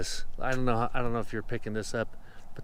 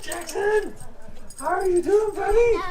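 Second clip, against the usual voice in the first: joyful reunion. Real, not acted. Greeting son after the trip.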